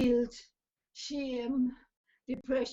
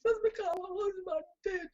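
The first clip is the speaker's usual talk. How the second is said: retold tearful complaint; 8 semitones higher than usual. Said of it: "I was bawling so loud".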